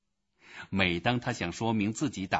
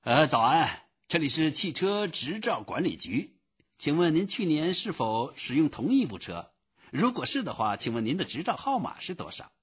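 higher, routine official voice; higher-pitched bureaucratic patter; new phone official; 7 semitones higher than usual. A narrator's reading. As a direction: recite the office greeting in a raised voice. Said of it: "另一个新的声音".

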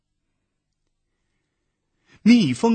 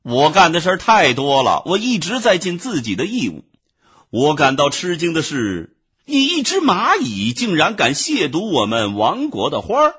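higher, louder indignant scolding; angry bee; 8 semitones higher, 6 dB louder than usual. A narrator's reading. Bee angrily scolds the ant for defiling flowers. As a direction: tell him off with offended pride. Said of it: "蜜蜂气愤地说".

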